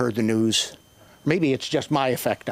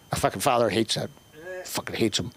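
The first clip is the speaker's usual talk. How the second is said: ordinary talk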